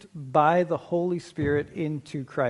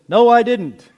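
loud, high-pitched protest; loud denial; 8 semitones higher, 11 dB louder than usual. Acted. Dunked man answers the preacher, loud high denial. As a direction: blurt it out, hammed-up, as the dunked man.

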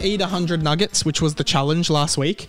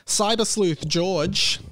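announcer call; 5 semitones higher, much slower than usual. Acted. Calling out listener name.

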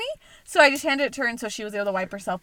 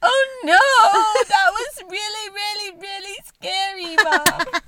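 imitating a scared toddler; 8 semitones higher, 5 dB louder, slower than usual. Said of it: "she's just like".

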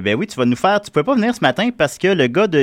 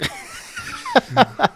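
1 burst of laughter; 3 dB quieter than usual. Real, not acted. Laughs at own vampire joke.

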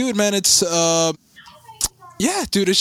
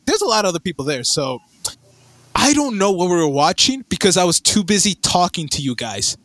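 ordinary delivery

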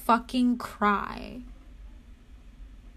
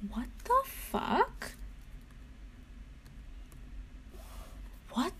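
high, soft and slow, each word drawn out; dazed, hushed "What the fuck?"; stunned disbelief; 9 semitones higher, 8 dB quieter, much slower than usual. Real, not acted. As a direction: let the disbelief sink in slowly. Stunned disbelief, repeated slowly.

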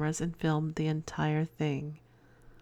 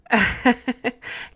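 surprised laugh; 5 semitones higher, 6 dB louder than usual. Real, not acted. Reaction to shocking email.

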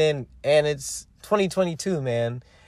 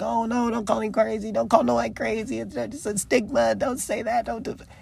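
high, soft mocking voice; mock the people who say it, pitched up and soft; mocking quote; 7 semitones higher, 5 dB quieter than usual. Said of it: "people are like".

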